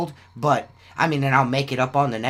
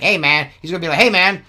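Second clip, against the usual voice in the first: louder, higher mock complaint from a customer; whiny customer; 6 semitones higher, 7 dB louder than usual. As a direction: imitate a guy griping about his shirt size. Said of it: "And he's like".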